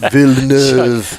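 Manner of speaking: Villeneuve drawn out slowly in a mock French accent, vil-nuv; terrible French accent. Shown as a demonstration. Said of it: "That's a terrible French accent".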